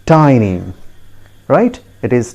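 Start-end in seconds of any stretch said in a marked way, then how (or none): 0.1-0.6 "tiny" as TY-nee, falling; correct pronunciation. Shown as a demonstration. Teacher telling learners the correct form, "tiny" as TY-nee. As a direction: say "tiny" with an eye vowel. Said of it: "Please repeat once again".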